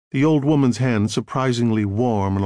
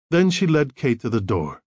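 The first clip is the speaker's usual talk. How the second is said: ordinary delivery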